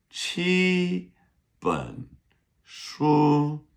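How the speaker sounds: teacher splits 七 本 书 into separate tones; word by word tones. Shown as a demonstration. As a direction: model 七 本 书 one syllable at a time. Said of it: "seven books".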